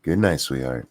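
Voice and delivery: put-on soft, low goodnight to a child; sweet voice. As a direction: tuck him in gently. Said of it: "With your sweet voice".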